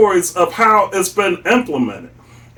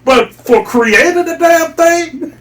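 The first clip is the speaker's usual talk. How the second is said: laughing rant; 7 semitones higher than usual. Real, not acted. Ranting about participation trophies.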